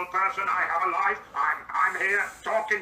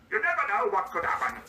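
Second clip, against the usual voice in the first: ordinary delivery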